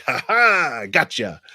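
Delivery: gloating little taunt; mock taunting gotcha. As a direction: play the troll catching you out. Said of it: "they're like".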